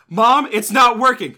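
loud, high, drawn-out mock complaint; hammed-up protesting yell; exasperated kid. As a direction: play a son calling out to mom in frustration. Acted complaint to mom.

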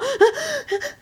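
high, sharp crying gasp; high mock sob intake; imitated sob gasp. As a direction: mimic a sob catching in the throat. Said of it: "estaba como llorando, así como".

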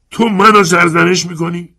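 high, loud outraged shout. Acted. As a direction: fling the accusation back. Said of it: "اسکالا فریاد زد".

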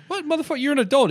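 high-pitched indignant disbelief; retold incredulous outburst. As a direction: imitate a buddy's indignant, high reply. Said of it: "And the guy goes".

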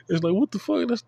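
high, rising disbelieving what the fuck; stunned and mocking; incredulous. Real, not acted. To critics quizzing him, an incredulous high what the fuck.

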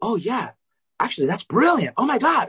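high-pitched mock professor's astonishment; amazed realization. Professor suddenly grasps student's idea.

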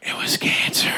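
hushed unvoiced whisper. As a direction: whisper it like a secret. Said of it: "It's always whispered".

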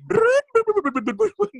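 loud, high revving snore noises; imitating snoring. Shown as a demonstration. Showing the actor's improvised snore, loud revving snore imitation. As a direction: mimic the improvised snore sounds. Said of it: "improvise เอง".

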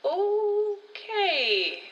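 high, drawn-out stunned okay; long startled okay; taken aback. Acted. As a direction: back off after an outburst.

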